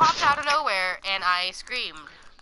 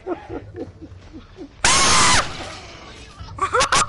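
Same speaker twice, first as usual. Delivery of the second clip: screaming laugh; 13 semitones higher, 5 dB louder than usual. Real, not acted. Scream after jump scare.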